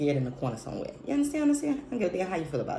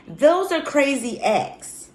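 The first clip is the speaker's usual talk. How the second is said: high and loud, every word stretched; emphatic verdict; 11 semitones higher, 8 dB louder, much slower than usual. Real, not acted. Vlogger ruling on what counts as crazy, emphatic verdict. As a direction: pronounce judgment slowly, at high volume.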